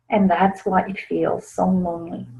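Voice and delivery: ordinary talk, nothing marked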